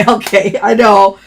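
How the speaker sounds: ordinary delivery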